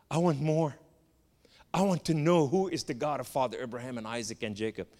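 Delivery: ordinary talk, nothing marked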